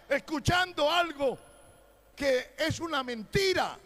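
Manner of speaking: loud, high, impassioned sermon; fiery preaching. Acted. Preacher denouncing false revelations to the congregation, shouting. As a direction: thunder it at the flock.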